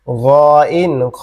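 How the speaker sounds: throaty gha sound then name kha; teacher demonstrates the letter from the throat; Arabic letter sound demo. Shown as a demonstration. Teacher shows students the throat letter, slow and clear demo. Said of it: "কণ্ঠনালীর শেষ ভাগ হচ্ছে".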